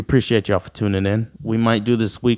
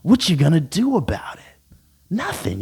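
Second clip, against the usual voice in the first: whispered taunt; 5 semitones higher than usual. Acted.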